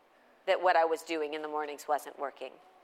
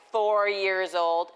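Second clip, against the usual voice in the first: slow, stretched and louder; exaggerated slow, louder emphasis; drawn out slowly; 5 dB louder, much slower than usual. Shown as a demonstration. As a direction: drag the words out for effect. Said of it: "I don't say it like that".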